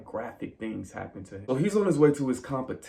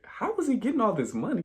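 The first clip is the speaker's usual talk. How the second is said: baffled question; 11 semitones higher, faster than usual. Retold or imitated.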